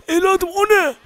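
put-on high old man's voice, pitched well up. Ahmet abi tells Metin where they are, high old voice. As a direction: warn him like the old neighbour, high.

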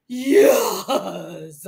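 loud, stretched-out cheer; triumphant yes. Acted. Boy getting dinosaurs with everything, cheering. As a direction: celebrate it with a big drawn-out shout.

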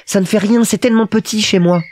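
put-on high, earnest little-boy voice; grave Petit Prince. A narrator's reading. Little prince remarks on his tiny home, high and earnest. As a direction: state it seriously, like a small child. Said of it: "le petit prince remarqua gravement".

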